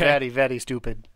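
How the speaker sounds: put-on Russian accent, the r in very said as an l; clumsy mock Russian accent, "vely, vely stupid"; bad Russian accent. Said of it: "That's my bad Russian accent".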